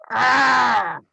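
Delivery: loud drawn-out monster cry; angry creature wail. Acted. Game master voices the wounded blight, angry wail. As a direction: wail like an angry medium-sized Groot. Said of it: "lets out an angry wail".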